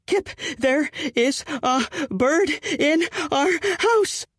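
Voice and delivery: high, slow panicked cry. Acted. Fiancée alarms Kip about bird indoors, high panicked call.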